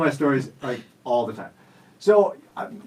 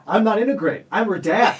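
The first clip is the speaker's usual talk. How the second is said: indignant retort; 8 semitones higher, 5 dB louder than usual. Retold or imitated. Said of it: "I was like".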